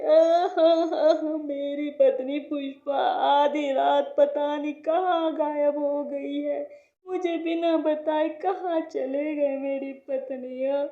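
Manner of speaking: slow, drawn-out theatrical sobbing; loud crying. Acted. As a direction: bawl like an abandoned husband. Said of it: "Kishan जोर जोर से रोने लगता है".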